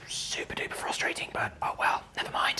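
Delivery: whispered frustrated aside; whispering. Real, not acted. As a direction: whisper it under your breath.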